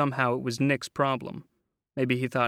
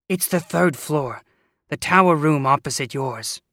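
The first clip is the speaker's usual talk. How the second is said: gruff landlady; 2 semitones higher, 8 dB louder than usual. A narrator's reading. Landlady voice, Cagney-like.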